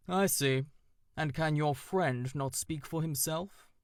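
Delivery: a wise owl questioning from his hollow; stern old owl. Acted. Owl voiced in story.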